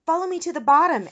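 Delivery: high, very loud command; urgent yell. A narrator's reading. Eric leads friends down the steps, urgent yell. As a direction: yell to rally the others. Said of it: "Eric yelled".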